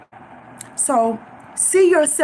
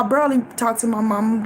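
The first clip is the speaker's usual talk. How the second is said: ordinary talk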